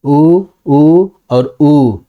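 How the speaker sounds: short उ, long उ, then prolonged उ; slow demonstration of the three lengths of उ; three vowel lengths. Shown as a demonstration. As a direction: stretch each उ slowly, longer every time. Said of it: "तीन प्रकार के उ हैं".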